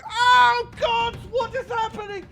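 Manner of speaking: high frantic panicked cry; panicked guard. Acted. Fleeing guard wails as he runs away. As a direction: shriek it while fleeing in terror. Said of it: "he's going".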